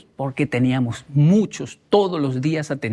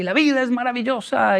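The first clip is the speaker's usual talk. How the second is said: imitated exuberant teacher; 7 semitones higher than usual. Imitating the teacher in every class, exuberant high cry. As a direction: proclaim it like an eccentric teacher. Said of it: "toda la clase era así".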